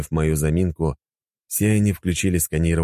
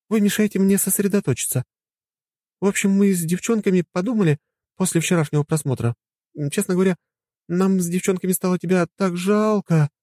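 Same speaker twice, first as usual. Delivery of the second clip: higher woman's voice, pitying; pitying wife. Acted. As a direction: speak with pity. Anya voiced. (с жалостью)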